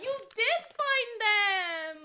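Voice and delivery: high, loud gasp of delight; thrilled discovery, voice jumps up; excited gasp. Real, not acted. Gasping at a found gift.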